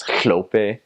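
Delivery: "hlope" with a breathy, slushy hl at the front; "hlope" begun with a wet lateral hl; breathy hl sound. Shown as a demonstration. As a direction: hiss the hl that starts "hlope". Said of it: "another syllable is hl".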